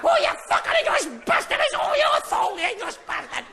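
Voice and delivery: very high-pitched shouted yapping; yappy terrier Scotsman. Acted. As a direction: yap like an angry little Scottish terrier. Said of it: "your little yappy terrier jock".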